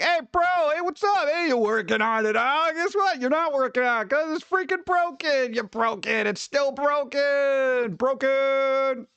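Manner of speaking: mock phone rant. Streamer mocking his ISP on the phone, high taunting voice. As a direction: needle the tech guy, singsong and snide.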